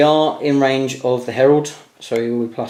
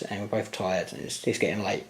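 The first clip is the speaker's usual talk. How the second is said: tired and quiet; 3 semitones lower, 10 dB quieter than usual. Real, not acted. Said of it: "getting quieter and quieter".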